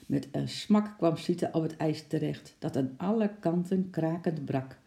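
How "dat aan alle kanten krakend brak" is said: usual speaking voice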